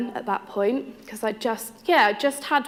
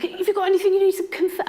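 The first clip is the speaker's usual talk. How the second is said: high, fast teasing accusation in a put-on voice; husband's mock suspicion; 11 semitones higher, faster than usual. Husband teasing his pregnant wife, high and fast mock suspicion. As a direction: play the suspicious husband, quick and high-pitched. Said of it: "he was like".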